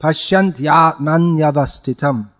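"Pashyant yatman" slow, a clear ya on "yatman"; ya pronounced. Shown as a demonstration. Teacher slowly showing the ya in "Pashyant yatman". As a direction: sound the ya in "yatman yavastitam" plainly. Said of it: "pronounce it".

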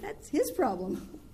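slow, deliberate and firm. Retold or imitated. Speaker answering the upset devotee, slow and firm. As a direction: spell it out slowly to her. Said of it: "I said".

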